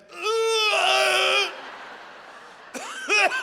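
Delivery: long, high, loud retching wail; hammed-up horrified howl; disgusted scream. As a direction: let out a drawn-out cry of revulsion. Acts recoiling from the smell.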